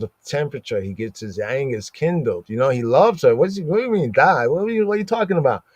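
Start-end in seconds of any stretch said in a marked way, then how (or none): 3.4-5.6 quick, pitched-up exasperated retort; mock exasperated Jacob. Jacob snapping back at Rachel, quick mock exasperation. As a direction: rattle it off like an irritated husband. Jacob voiced as angry husband.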